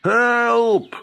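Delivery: high, frantic cry for help. A narrator's reading. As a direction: scream for help as the beaten friends. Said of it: "cried the two friends".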